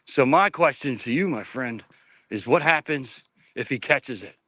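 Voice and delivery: normal talking voice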